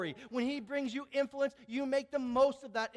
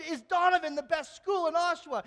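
louder, higher call to the crowd; rallying question; 6 semitones higher, 6 dB louder than usual. Retold or imitated. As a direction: pump the room up. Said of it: "I said".

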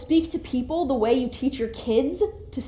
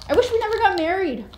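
mock hurtful insult; 9 semitones higher, 5 dB louder, faster than usual. Kids acting out hurtful words.

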